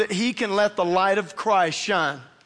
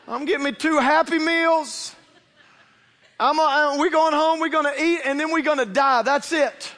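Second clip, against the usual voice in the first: higher, louder whiny church member; mock complaining congregant; 4 semitones higher, 4 dB louder than usual. Preacher imitating a church member moaning about being broke, whiny. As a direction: ham up a self-pitying member. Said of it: "none of you in here sitting there like".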